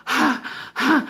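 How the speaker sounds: mock exasperated huff into the phone; heavy phone breathing. Mimicking a rude worker answering, huffy breaths. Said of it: "breathe into the phone and shit".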